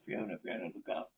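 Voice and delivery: put-on breathless whisper over a fainted girl; hushed pleading whisper; urgent whisper. A narrator's reading. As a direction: whisper her name to wake her. Charles pleads over fainted Fiona.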